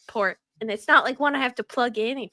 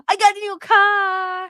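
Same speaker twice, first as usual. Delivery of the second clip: slow, loud, higher proclamation; excited announcement; 9 semitones higher, 8 dB louder than usual. Real, not acted. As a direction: announce it proudly.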